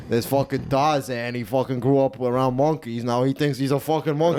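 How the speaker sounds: drawling Italian-American accent. Shown as a demonstration. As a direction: retell it as an Italian wise guy. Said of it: "with that Italian voice".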